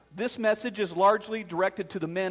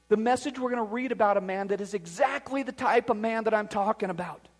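usual speaking voice

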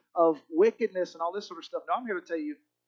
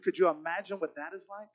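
ordinary talk, nothing marked

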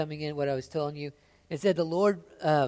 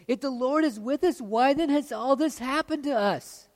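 loud, high, pleading protest; anguished loud complaint; 9 semitones higher, 7 dB louder than usual. Acted. Gideon crying out loudly at the Lord. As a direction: cry it out to heaven like a wronged man.

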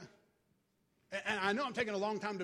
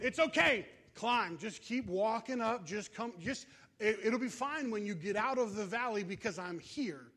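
raised voice, as if to someone far off; calling encouragement. Acted. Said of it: "here at the end of my valley".

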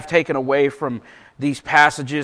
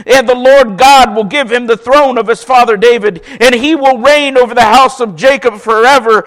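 raised, louder preacher reading voice; scripture reading; 5 semitones higher, 5 dB louder than usual. Acted. Pastor reading Luke aloud to the church, raised proclaiming voice. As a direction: proclaim the passage to the congregation.

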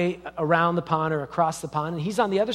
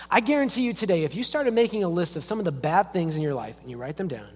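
ordinary delivery

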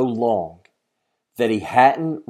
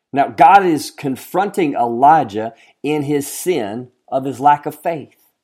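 usual speaking voice

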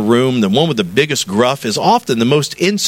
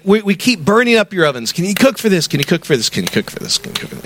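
repeated request, starting high, fading away; insistent asking; 6 semitones higher, faster than usual. Retold or imitated. Pastor piling requests on church members, insistent and trailing off. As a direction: rattle off the same request, sinking to nothing.

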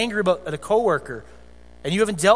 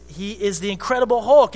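usual speaking voice

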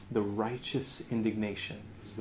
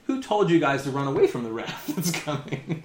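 laughing; 3 semitones higher, 4 dB louder than usual. Retold or imitated. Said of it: "John's question to the Pharisees".

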